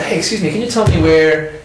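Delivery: confident. Retold or imitated. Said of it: "just really confidently said".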